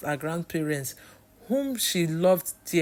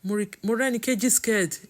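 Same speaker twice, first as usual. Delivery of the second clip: usual speaking voice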